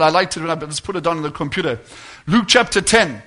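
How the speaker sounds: ordinary delivery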